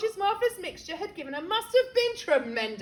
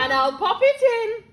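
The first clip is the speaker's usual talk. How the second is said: much louder, higher cheeky promise; 6 semitones higher, 10 dB louder than usual. Acted. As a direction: announce it with glee. George cheeky, loud.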